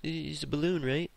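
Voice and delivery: high, innocent playing-dumb voice. Kid to angry principal, playing dumb. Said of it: "I'm playing stupid".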